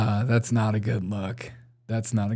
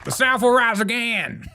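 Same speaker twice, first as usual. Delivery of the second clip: higher, hammed-up Southern general; pompous mock general, pitched up; mock Confederate general; 8 semitones higher than usual. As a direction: ham up an old Civil War general rallying the South. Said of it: "a little Civil War general in the back".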